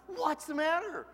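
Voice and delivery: jolted awake and demanding what's wrong; dad shocked awake by his son, high and loud; startled. Retold or imitated. Startled father woken at night.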